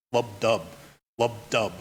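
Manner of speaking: low "lub DUB", weak then strong, pausing between pairs; heartbeat rhythm. Shown as a demonstration. Lecturer imitating a beating heart, rhythmic "lub dub". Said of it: "the beating of the human heart".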